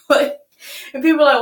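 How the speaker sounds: ordinary talk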